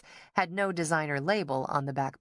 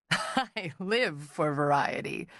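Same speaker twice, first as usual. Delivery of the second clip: giggling; slower than usual. A narrator's reading. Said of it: "Fairchild said with a giggle".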